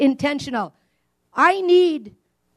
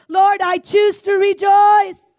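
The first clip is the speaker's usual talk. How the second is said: slow, loud, higher declaration of praise; declaring rejoicing; 6 semitones higher, 4 dB louder, slower than usual. Acted. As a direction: proclaim it like a shout of faith. Pastor voicing a believer's prayer.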